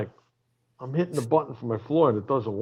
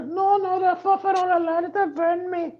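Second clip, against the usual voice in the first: mock housekeeper voice. Comic teasing the rock star as a falsetto housekeeper. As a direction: ham up a high-pitched hotel maid. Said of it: "I'll be like this".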